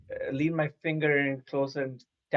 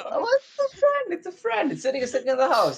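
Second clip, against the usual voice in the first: much higher, pleading squeal; high-pitched pleading. Real, not acted. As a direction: plead that it's harmless. Insisting rat is friend.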